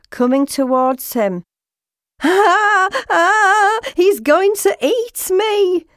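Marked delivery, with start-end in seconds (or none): 2.2-5.8 high, panicked screams and cry; terrified shout. A narrator's reading. Frog Lim seeing a scary pike coming, terrified shout. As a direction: scream in fright, then wail the words high. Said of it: "Lim shouted".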